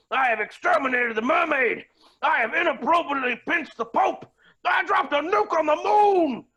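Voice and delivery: boastful build-up. Acted.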